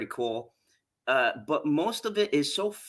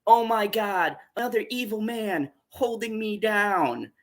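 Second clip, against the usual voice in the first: mock dramatic epiphany, pitched up; hammed-up higher voice of a wronged villainess; Evil-Lyn; 6 semitones higher than usual. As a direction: imitate a melodramatic revelation. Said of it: "Evil-Lyn has an epiphany".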